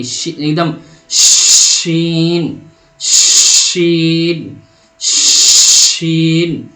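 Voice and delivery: شین stretched long with a strong rushing sh; شین held slowly with a loud hushing sh; airy drawn-out sheen. Shown as a demonstration. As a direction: push lots of air through the sh of شین. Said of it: "اس میں زیادہ آواز نکلے گی".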